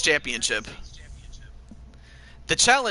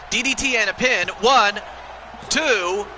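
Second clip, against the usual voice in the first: wrestling commentator; 3 semitones higher than usual. Acted. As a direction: call the pinfall with rising energy. Calling the pin and finish.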